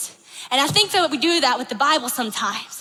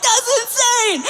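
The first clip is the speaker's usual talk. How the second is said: much higher, mock-astounded outburst; freaking out; 8 semitones higher, 3 dB louder, slower than usual. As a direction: act totally mind-blown, pitched high. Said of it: "we all freak out".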